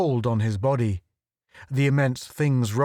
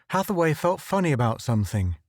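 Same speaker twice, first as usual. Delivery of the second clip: plain reading voice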